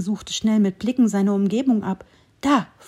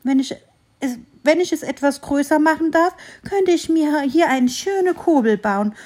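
higher, louder squirrel thinking aloud; musing squirrel; 6 semitones higher, 4 dB louder than usual. A narrator's reading. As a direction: weigh the idea in a small squirrel voice. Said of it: "überlegte das Eichhörnchen". (überlegendes Eichhörnchen, höher und lauter)

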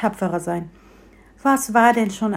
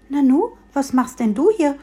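surprised; 6 semitones higher, 5 dB louder than usual. A narrator's reading. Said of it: "fragte das Bäumchen".

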